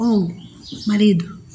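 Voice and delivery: normal talking voice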